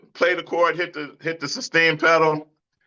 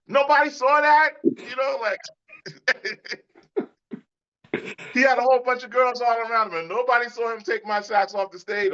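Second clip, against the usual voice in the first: laughing; 10 semitones higher than usual. Real, not acted. Laughing retelling of theft.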